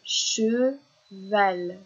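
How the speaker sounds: "Cheval" in two drawn-out beats; "che", pause, "val", teacher's syllable demo; split into syllables. Shown as a demonstration. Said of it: "combien de syllabes".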